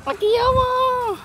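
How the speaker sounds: high, slow coo at something cute. Real, not acted. Cooing at a small bug.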